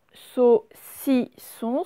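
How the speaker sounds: SAUCISSON slowly as SAU-CI-SSON; loud, separated syllables in SAUCISSON; split into syllables. Shown as a demonstration. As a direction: sound out SAUCISSON for pupils. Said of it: "C'est dans la deuxième syllabe".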